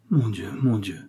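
understated sighing plea; sighed lament. A narrator's reading. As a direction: let the words sink like a sigh. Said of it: "soupira-t-elle".

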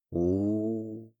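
soft drawn-out oo of sympathy; drawn-out sympathetic oo. A narrator's reading. Selina pities the heroine: drawn-out oo. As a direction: stretch a quiet sympathetic oo. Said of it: "протянула девушка".

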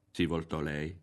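ordinary delivery

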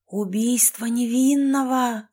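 usual speaking voice

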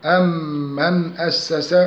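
أم من run together on a held nasal m; tajweed merging with ghunna. Shown as a demonstration. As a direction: hum the joined m of أم من before أسس. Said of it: "وجب الإدغام بغن مقدارها حركتان".

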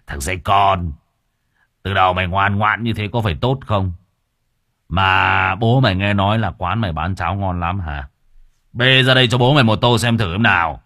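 loud swaggering gangster; gloating thug. Acted. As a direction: lord it over him. Gloating thug demands porridge.

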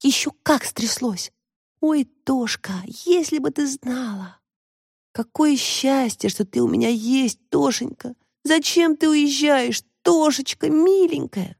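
high, slow, breathless gush; swooning, drawn-out girlish drama; dramatic confiding. A narrator's reading. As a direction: pour it out theatrically to a best friend. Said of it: "перешла на драматический шёпот Таська".

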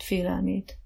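ordinary delivery, nothing marked

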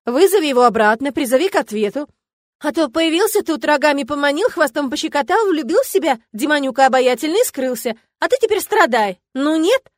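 rally a friend to get even; combative, heated declaration; militant. A narrator's reading. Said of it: "воинственно заявила блондинка".

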